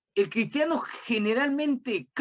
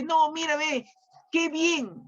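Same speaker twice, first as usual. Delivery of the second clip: higher, sly coaxing devil; tempting devil voice; 7 semitones higher than usual. Retold or imitated. Preacher voicing the devil luring believers, high and sly. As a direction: lure them in like the devil. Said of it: "el diablo te dice".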